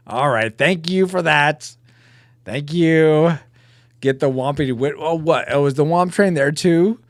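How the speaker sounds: ordinary talk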